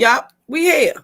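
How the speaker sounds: upbeat "Yup, we here" with a lift on here; catchphrase. Shown as a demonstration. Host saying her catchphrase to the group, upbeat and light. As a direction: toss off "we here" like a motto. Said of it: "As we say".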